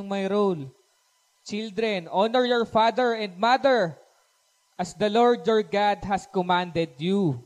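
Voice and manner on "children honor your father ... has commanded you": slow, weighty Bible reading; scripture reading. Acted. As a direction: proclaim the verse slowly. Reads scripture aloud. (mabagal na pagbasa ng Banal na Kasulatan)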